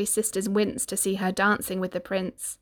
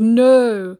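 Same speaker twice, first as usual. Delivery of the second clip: loud horrified no; scream; 2 semitones higher, 10 dB louder than usual. Acted. Prince screaming at the ugly sister, loud and horrified. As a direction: scream it in horror. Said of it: "and the prince screamed".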